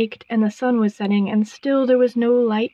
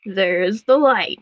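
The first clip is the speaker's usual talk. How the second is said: croaking frog queen; 3 semitones lower, slower than usual. A narrator's reading. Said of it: "The frog croaked".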